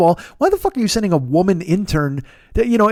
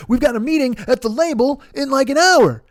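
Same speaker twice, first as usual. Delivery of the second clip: lightly acted movie quote, pitched up; John C. Reilly; 7 semitones higher than usual. Quoting Boogie Nights to the audience, higher mock whine. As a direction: imitate a petulant movie character, higher. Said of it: "where John C. Reilly's like".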